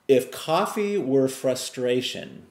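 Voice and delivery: first take, slowed for weight; slow emphatic question. Shown as a demonstration. Speaker poses the question to viewers, slow and emphatic.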